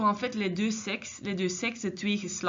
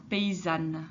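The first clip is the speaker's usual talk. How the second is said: paysanne said slowly, ending -anne clear; slow careful teacher example; slow model; 4 semitones lower than usual. Shown as a demonstration. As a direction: draw out paysanne for the class. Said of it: "redoublement de la consonne N".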